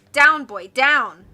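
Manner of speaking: loud, firm command to a dog; stern loud dog command; ordering. A narrator's reading. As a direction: order the dog down, falling hard. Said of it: "Ned ordered".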